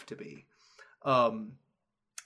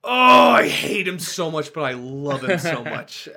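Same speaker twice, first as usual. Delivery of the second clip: gushing players; 4 semitones higher, 12 dB louder than usual. Retold or imitated. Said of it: "my players were like".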